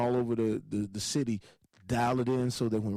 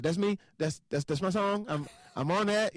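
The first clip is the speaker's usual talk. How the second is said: pitched high, tumbling over repeats; excited boasting; 8 semitones higher than usual. Retold or imitated. Singer grabs the club mic as his song plays, excited. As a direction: proudly claim it, voice lifted high.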